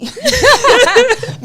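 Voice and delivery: high mock newsreader, drawn out; BBC news voice. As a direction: ham up a posh news announcer. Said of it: "Radio Four".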